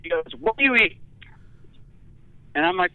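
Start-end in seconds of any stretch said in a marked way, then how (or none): 0.4-1.0 lightly voiced stern nurse; demanding nurse.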